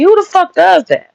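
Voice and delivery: real incredulous outrage; exasperated outburst. Real, not acted. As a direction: throw up your hands in disbelief. Exasperated rant about scientists.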